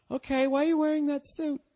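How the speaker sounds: very high mock girlfriend; put-on girlfriend voice. Acts out girlfriend questioning him.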